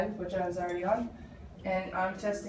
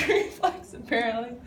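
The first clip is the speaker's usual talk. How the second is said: laughing; 3 semitones higher, 5 dB louder than usual. Real, not acted. Laughing at patient's gag.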